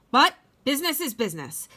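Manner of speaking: fast, firm shrug-off; brisk dismissal. Acted. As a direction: rattle it off to brush away guilt. Onceler justifying himself.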